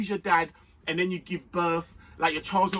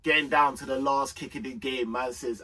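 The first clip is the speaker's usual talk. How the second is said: ordinary talk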